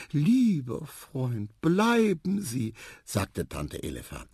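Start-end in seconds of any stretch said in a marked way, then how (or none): 0.1-2.7 high, drawn-out entreaty; gushing aunt. A narrator's reading. Aunt begging the marabou to stay, high gushing plea. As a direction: coax the guest slowly, voice up high.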